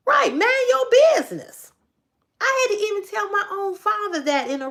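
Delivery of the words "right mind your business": high, loud, firm mind your business; emphatic telling-off. Retold or imitated. Mother telling her father to back off, firm and loud. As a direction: slap it down, it's my business.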